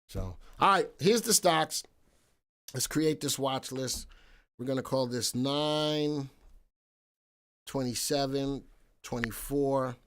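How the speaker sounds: usual speaking voice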